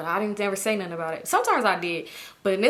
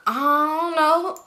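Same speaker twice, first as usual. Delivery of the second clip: slow, high, drawn-out innocent dodge; feigned ignorance; 8 semitones higher, 4 dB louder, much slower than usual. Retold or imitated. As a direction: stretch it out, playing dumb.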